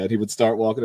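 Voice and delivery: normal talking voice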